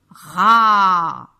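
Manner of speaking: "Ra" very loud, vowel held long; vowel sounding-out. Shown as a demonstration. Teacher making children hear the A, "Ra" loud and stretched. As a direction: stretch the a of "Ra" loudly. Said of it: "j'entends A".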